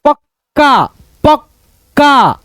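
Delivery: पक्का broken as pak, pause, kaa; syllable-split demonstration. Shown as a demonstration. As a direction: slowly split पक्का at the doubled क. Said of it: "ऐसे करके".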